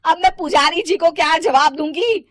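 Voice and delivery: high, loud scolding that eases at the end; irritated. Acted. Mother worries about facing the priest, irritated. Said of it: "माँ चिढ़कर कहती हैं".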